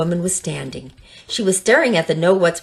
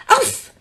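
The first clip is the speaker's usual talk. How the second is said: oof. Acted. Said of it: "yelled Dinky".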